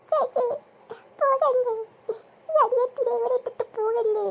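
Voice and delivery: hammed-up tearful begging; acted crying. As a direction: wail like an abandoned little sister. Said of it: "ആ കൊച്ചിനെ കരയിപ്പിച്ചു".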